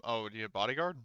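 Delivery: ordinary talk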